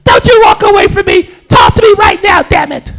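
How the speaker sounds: very high, loud mock screech of a girlfriend; hammed-up arguing yell. Crackhead girlfriend demanding Charlie's attention at gas station, hammed-up yelling. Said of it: "she's yelling all loud".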